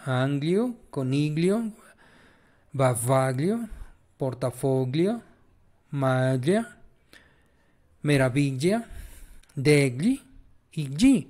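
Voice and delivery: gli in figlio and voglio as a palatal ly; gli pronunciation demo. Shown as a demonstration. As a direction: list words slowly with rising tune, no hard g in gli. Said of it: "Es como G".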